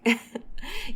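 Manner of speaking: light rising chuckle; soft laugh. A narrator's reading. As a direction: laugh gently at her. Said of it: "وقعد يضحك". (ضحكة خفيفة)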